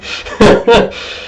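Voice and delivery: high breathy giggle; sheepish high giggle; embarrassed laugh. Real, not acted. Embarrassed at bad mic.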